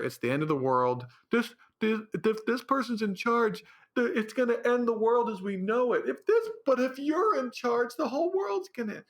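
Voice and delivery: rising high, hammed-up alarm; hammed-up doomsday panic mocking both sides. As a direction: parody the doomsayers.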